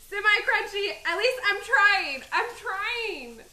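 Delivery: loud, high joking protest; 1 defensive laughing protest. Real, not acted. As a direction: plead your case with a laugh.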